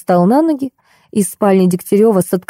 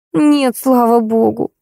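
slow, higher-pitched mild moan; groaning; 4 semitones higher, slower than usual. A narrator's reading. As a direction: drag it out plaintively. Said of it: "простонала Ольга". (медленно, с лёгким стоном)

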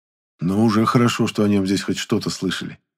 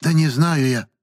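put-on high-pitched, exasperated old woman; touch of helpless irritation; 7 semitones higher, slower than usual. A narrator's reading. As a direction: protest in mild vexation. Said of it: "всплеснула руками старуха в сердцах".

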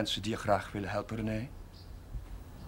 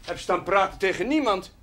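frustrated outburst; 4 semitones higher, 7 dB louder than usual. Acted. Priest demands an answer.

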